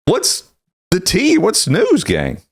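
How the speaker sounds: high sing-song "What's the tea?" with a slow rise on "tea"; playful high greeting. Real, not acted. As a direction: greet the gang in a bright high voice. Host greets co-hosts playfully.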